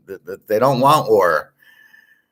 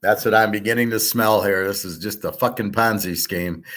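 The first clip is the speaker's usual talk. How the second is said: usual speaking voice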